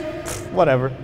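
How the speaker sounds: scoffing pfft then a bored whatever; mock a bored teen brushing it off; dismissive whatever. Shown as a demonstration. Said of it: "what do you say first?".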